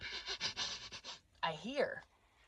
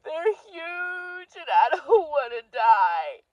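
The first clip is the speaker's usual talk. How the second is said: high wailing sob, slow, hammed-up; mock bawling; 11 semitones higher, 5 dB louder than usual. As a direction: wail it like you're about to be eaten. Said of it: "People, I'm bawling".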